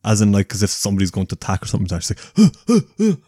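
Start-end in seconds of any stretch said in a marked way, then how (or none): 2.3-3.2 high, loud startled gasping; panicked gasps. Retold or imitated. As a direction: gasp as if about to be attacked. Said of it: "like, panic".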